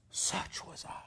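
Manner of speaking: breathy whisper; whispered regret. Acted. Ghost whispering his own failure to Scrooge. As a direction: let it fall to a whisper of regret.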